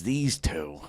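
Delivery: low quiet grumble; low mutter. Retold or imitated. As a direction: mutter it low under your breath. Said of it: "He goes".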